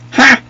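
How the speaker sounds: high, loud burst of laughter; triumphant "ha". Real, not acted. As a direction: bark out one victory laugh. Triumph after a kill.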